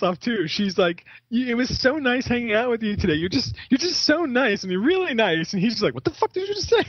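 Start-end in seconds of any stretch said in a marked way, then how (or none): 1.3-5.5 high-pitched, sweet-talking lady impression; mock gushing. Voices movie lady's gushing compliments. 5.9-6.8 very high-pitched affronted squeal; mock outrage. Voices movie character's outraged comeback.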